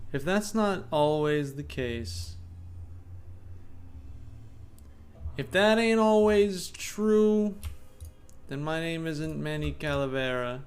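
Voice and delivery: slow hardboiled drawl; lightly voiced noir gumshoe; noir detective. Streamer voices noir detective monologue.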